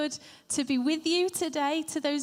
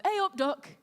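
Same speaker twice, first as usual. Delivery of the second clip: higher, chirpy Yorkshire greeting; Leeds accent; 6 semitones higher than usual. Acted. Student newly in Leeds greeting people, Yorkshire accent. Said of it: "when I moved to Leeds".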